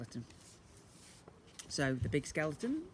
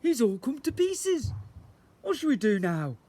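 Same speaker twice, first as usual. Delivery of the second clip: higher, louder worried skeleton; a lightly voiced skeleton in dismay; 8 semitones higher, 6 dB louder than usual. A narrator's reading.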